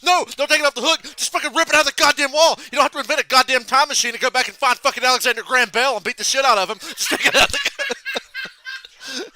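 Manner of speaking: high, loud relentless rant; angry Bernie. Retold or imitated. Imitating Bernie ranting about the ringing phone, hammed-up fury. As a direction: rage on, climbing until laughter breaks in. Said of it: "Angry Bernie's".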